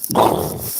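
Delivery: loud burst of mouth-made crash noise; sudden explosive vocal whoosh; imitated crash noise. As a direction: make the boom of an impact.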